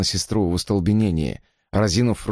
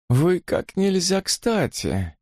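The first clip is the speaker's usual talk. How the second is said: cryptic; 10 semitones higher, much slower than usual. Acted. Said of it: "загадочно бросил плантатор".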